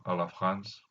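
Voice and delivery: ordinary talk, nothing marked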